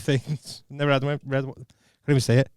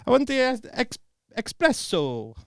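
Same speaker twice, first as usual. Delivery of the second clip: espresso in a mock Italian accent; a hint of Italian accent on espresso; 9 semitones higher, 4 dB quieter than usual. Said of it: "in an Italian accent".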